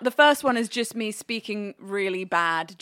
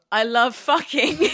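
laughing through the quote; 6 semitones higher, slower than usual. Retold or imitated. Retold embarrassing German reply.

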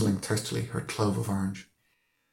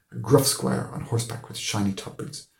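ordinary delivery

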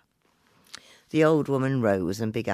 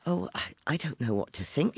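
trembling; 6 semitones higher, faster than usual. Acted. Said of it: "Mary was trembling".

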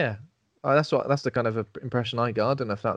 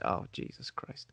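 whispered, slow and fed up; exasperated whisper; 13 dB quieter, slower than usual. Retold or imitated. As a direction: breathe it out in despair. Voicing her reaction to boyfriend.

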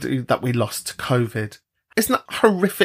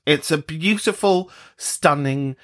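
ordinary delivery

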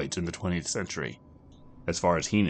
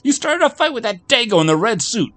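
high, loud puzzled bartender; a barman baffled by an angry customer; 11 semitones higher, 12 dB louder than usual. Acted.

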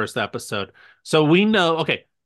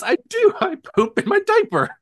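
put-on squeaky, much higher and louder little kid; toddler voice; 5 dB louder than usual. Toddler son insisting to his dad, squeaky and loud. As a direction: play a stubborn toddler insisting.

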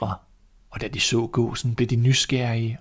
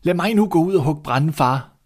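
higher, louder eager begging; eager pleading son; 6 semitones higher, 4 dB louder than usual. Acted. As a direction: beg his father to let him go. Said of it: "blev ved at tigge".

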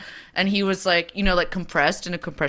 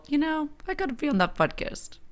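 imagined guest; 10 semitones higher, 8 dB quieter than usual. Retold or imitated. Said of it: "then they go".

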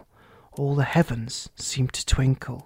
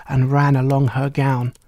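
plain reading voice

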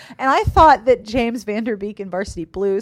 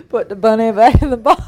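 mock Southern accent; 7 dB louder than usual. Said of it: "It was the worst Southern accent".

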